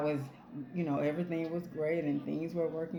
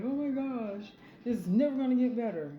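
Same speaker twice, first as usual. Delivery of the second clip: mock despair; 8 semitones higher, 5 dB louder than usual. Mocking a despairing listener.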